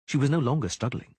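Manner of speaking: normal talking voice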